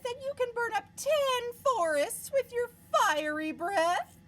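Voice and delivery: high princess voice. Acted. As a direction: lay on the flattery. Elizabeth flatters the dragon.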